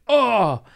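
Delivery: high, loud mock groan; hammed-up yelp of pain. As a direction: cry out as if doubled over. Acts out being halted by pain.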